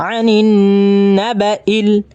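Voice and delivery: "আনিন না বা ইল" chanted with a long hummed n; measured recitation with nasal hum; ghunnah nasal hold. Shown as a demonstration. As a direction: linger on the n of "আনিন", then short syllables. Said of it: "গুণা করে পড়ে নেবেন".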